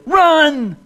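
a sudden high, loud cry to flee; urgent inner shout. Retold or imitated. Preacher recalling fear in the dark woods, high panicked shout. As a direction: yell it like panic grabbing you. Every fiber in me said run.

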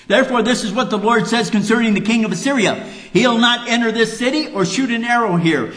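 high, loud and fast, proclaiming God's words; preaching at full force. Acted. Preacher booming the Lord's promise to the congregation, full force. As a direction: thunder it out like a fiery preacher.

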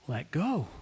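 soft, calm divine voice; gentle voice. Acted. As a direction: say it softly, with calm. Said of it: "a gentle voice says again".

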